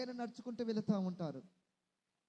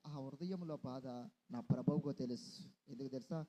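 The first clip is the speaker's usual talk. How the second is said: tender comfort; 7 semitones lower than usual. Acted. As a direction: comfort a weeping mother gently. Comforting a grieving listener.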